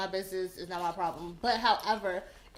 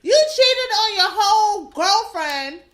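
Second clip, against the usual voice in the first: outraged; 6 semitones higher, 9 dB louder than usual. Real, not acted. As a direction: call him out, furious. Outraged rant about cheater.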